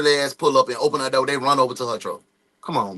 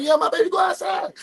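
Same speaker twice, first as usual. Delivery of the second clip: 2 higher, shouted mock answer; 1 mock neighbor; 11 semitones higher, faster than usual. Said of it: "She like".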